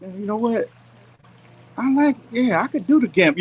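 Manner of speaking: a sudden rush of excitement at the idea; excited inner voice. Retold or imitated. To himself, dreaming of moving to the Gambia, excited and high. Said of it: "thinking to myself".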